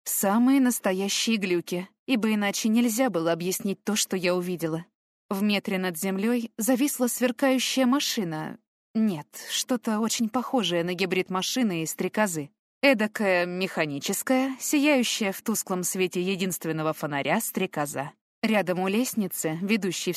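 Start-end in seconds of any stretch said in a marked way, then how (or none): none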